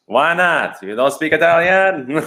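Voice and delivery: put-on nonna's indignant question in an Italian accent; Italian grandmother. Italian grandmother scolding her grandchild for not understanding, Italian accent. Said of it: "her thick Italian accent still".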